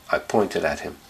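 ordinary delivery, nothing marked

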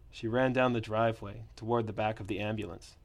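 ordinary delivery

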